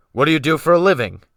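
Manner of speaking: loud, fast, sharp demand. A narrator's reading. Captain demanding a stranger's trade, sharp and fast. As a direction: bark the question at him. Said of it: "he sharply demanded".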